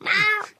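short, very high amused giggle; a quick bright laugh at his fuss; giggling at the panicking cat. Acted.

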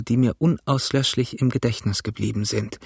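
ordinary delivery

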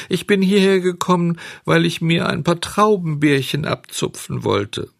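sehr hoch, weinerlich; schluchzend. Acted. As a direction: unter Tränen erklären. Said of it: "schluchzte Bengele". (Bengele erklärt dem Bauern weinerlich)

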